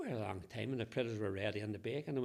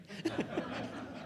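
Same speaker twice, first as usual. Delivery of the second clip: laugh; 9 semitones higher than usual. Real, not acted. After the orphan punchline.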